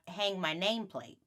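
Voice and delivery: usual speaking voice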